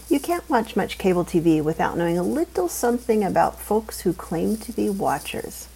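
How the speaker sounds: plain reading voice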